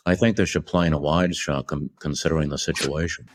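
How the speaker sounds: low-key Bronx director voice; Stanley Kubrick impression. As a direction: imitate a Bronx-born director giving notes. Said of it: "He's from the Bronx".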